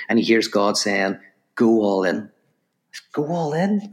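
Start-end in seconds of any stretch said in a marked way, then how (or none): 3.1-3.9 high, rising echo of disbelief; incredulous question. Retold or imitated. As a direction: throw the order back as a question. Character questions the command back.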